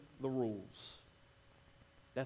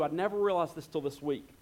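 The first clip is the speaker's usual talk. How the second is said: ordinary talk, nothing marked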